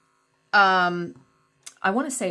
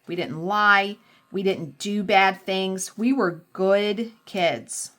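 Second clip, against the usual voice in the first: ordinary talk, nothing marked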